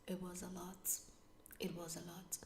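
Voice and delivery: "It was a lot" whispered, drained and unvoiced. Real, not acted. To viewers, recalling how overwhelming it was, a drained whisper. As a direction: let "It was a lot" fade to a tired whisper.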